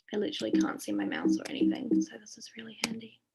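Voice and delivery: hushed, breathy whisper to herself; whispered aside. Real, not acted. Vet stuck on a frozen screen share, whispering to herself. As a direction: mutter it under your breath, half to yourself.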